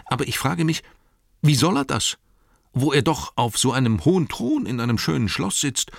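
high-pitched, incredulous innkeeper chatter; baffled innkeeper. Acted. Innkeeper doubts the king cares about his pub, baffled.

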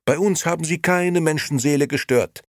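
very high, loud protest; incensed. A narrator's reading. Liliana stands up for the old men, incensed. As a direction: defend them indignantly. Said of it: "erwiderte Liliana aufgebracht".

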